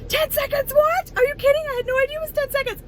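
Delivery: high, fast disbelieving squeal at the nurse; mock shocked disbelief. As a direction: replay the shock like she can't believe it. Retold shock at test length.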